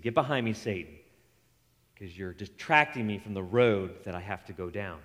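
low, quiet firm rebuke; low stern rebuke. Retold or imitated. Jesus turning on Peter, low and firm. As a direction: drop the voice and press it down.

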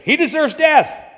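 high, loud shout of condemnation; shouted verdict. Acted. Council shouting its death verdict at Jesus, loud and high. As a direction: yell for his death. Crowd answers the high priest.

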